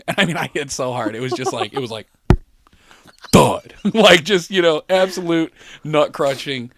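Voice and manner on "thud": short, loud, heavy "thud"; imitated thud. Storyteller mimicking the crash landing, loud thud. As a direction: drop the word like a body landing.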